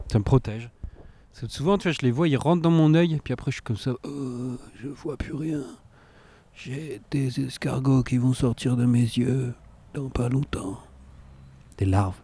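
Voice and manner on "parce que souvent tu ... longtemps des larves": Père Fouras voice. Pretend. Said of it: "Tu viens d'imiter le gars de Fort Boyard".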